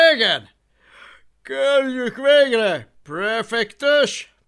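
slow, drawn-out yawning voice; yawning consul. A narrator's reading. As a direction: fat consul yawning with boredom. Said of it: "ásított nagyot a gömböc konzul".

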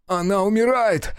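высокий громкий вопль; вопит в панике. A narrator's reading. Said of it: "завопил Люк". (Люк вопит, снимая цепь)

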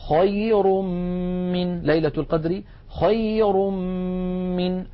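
خير من recited with the tanween becoming a long hummed m; tanween turned to meem. Shown as a demonstration. Teacher showing tanween before meem, merged hummed m in خير من. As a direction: close خير onto a held double m into من. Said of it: "فتحول التنوين إلى ميم".

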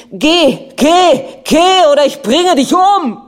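high, loud, exploding shout; furious scream. A narrator's reading. Prince raises the paperweight at his wife, screaming. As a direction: scream the threat at full force. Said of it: "riss ihn hoch und schrie".

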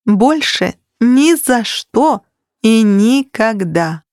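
each word drawn out firmly; slow emphatic vow. A narrator's reading. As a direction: lay down the law slowly.

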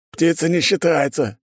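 high-pitched, cross guard voice; mildly angry retort. A narrator's reading. Humiliated guard answers his jeering mate, mildly angry. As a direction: snap back, only mildly spiteful. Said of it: "злобно прорычал тот".

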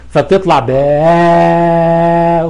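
باء drawn out very slowly into one unbroken baa; long aa demo. Shown as a demonstration. Teacher showing two short vowels merged into one, slow held baa. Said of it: "لا فاصل بينهما في الزمن".

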